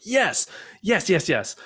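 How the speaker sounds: high, eager "yes" repeated; imitating an eager yes. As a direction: act out her emphatic yes. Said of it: "And she was emphatic".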